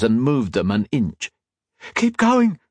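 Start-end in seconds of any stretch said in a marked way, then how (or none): 1.9-2.6 high, pressing cry; gasped urgency. A narrator's reading. Octavia fleeing the hounds, gasped high urging. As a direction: urge them on, pitched way up. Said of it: "Octavia gasped".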